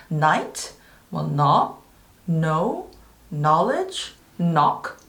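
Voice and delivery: Night, knob, know, knowledge, knock with the K silent, starting on N; each word opens on a plain N, as in "know" said like "no". Shown as a demonstration. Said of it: "K가 묵음 처리되는 단어들이".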